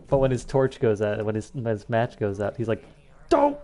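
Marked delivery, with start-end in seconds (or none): 3.3-3.6 sudden imitated cartoon cry; really loud yelp. Gamer imitating Cortex when his match goes out, loud yelp. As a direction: burst out with one short loud exclamation. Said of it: "Like, really loud".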